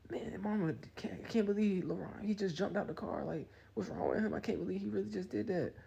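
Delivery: high-pitched mock complaint; upset girlfriend. Girlfriend phoning her mama, high mock disbelief. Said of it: "she called her mama".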